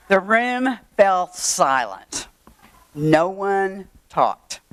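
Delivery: very slow, word by word; dramatic slow telling. Retold or imitated. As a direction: draw out each word for suspense. Dramatic pause after her faux pas.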